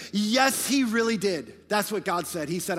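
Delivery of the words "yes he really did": louder, firm and certain; emphatic yes. Acted. Preacher answering the enemy, emphatic and louder. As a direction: declare it with full conviction. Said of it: "our answer has to be emphatically".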